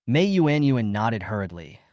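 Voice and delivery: ordinary delivery, nothing marked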